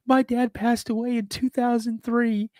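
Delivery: high-pitched tearful wail; acted-out bawling. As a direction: blurt it out as if still sobbing. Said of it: "I started bawling".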